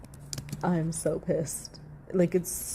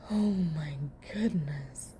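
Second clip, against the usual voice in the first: slow breathless whisper; whispered aside; 3 semitones lower, 5 dB quieter, slower than usual. Real, not acted. Streamer overwhelmed by the testimony, whispering. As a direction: sigh it out under your breath.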